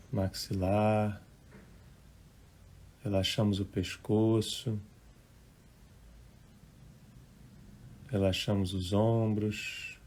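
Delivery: unhurried, hushed relaxation guidance; guided meditation. Real, not acted. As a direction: gently guide listeners into relaxing, phrase by phrase.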